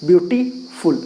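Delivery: ब्यूटीफुल as two pieces, a gap before फुल; split into syllables. Shown as a demonstration. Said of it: "तो दो अलग हो गया". (ब्यूटीफुल के दो हिस्से अलग-अलग बोलकर)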